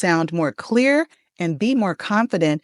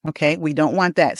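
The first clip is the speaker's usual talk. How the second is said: ordinary talk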